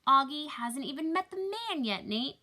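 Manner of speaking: loud, high-pitched scolding; exasperated protest. Acted. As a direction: chide a joking husband. Mom protests dad's teasing.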